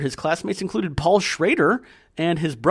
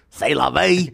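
put-on French accent; 4 dB louder, slower than usual. Jokey French phrase.

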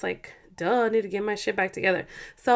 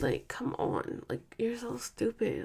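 unvoiced whispered scolding; 10 dB quieter, slower than usual. Real, not acted. Whispering to her husband so the niece stays asleep, hushed. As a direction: hiss it under your breath. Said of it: "Like, I'm sorry".

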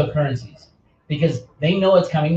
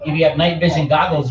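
usual speaking voice

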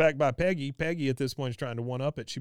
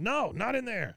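mock yell; 10 semitones higher than usual. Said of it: "Khan yells at him".

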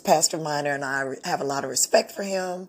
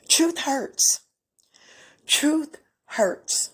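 higher, tearful repeat of a hard truth; choked up; 9 semitones higher, much slower than usual. Real, not acted. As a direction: voice catching as emotion rises. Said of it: "I'm becoming emotional right now".

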